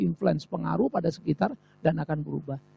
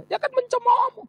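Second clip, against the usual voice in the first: much higher, sneering lilt; mock the husband's scorn; husband scoffing at his churchgoing wife, high and sneering. Mocking husband.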